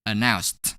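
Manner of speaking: "Announced" ending in a crisp T sound; ED pronounced as T. Shown as a demonstration. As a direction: say "Announced" with the ED as a single T. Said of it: "pronounce E D as T".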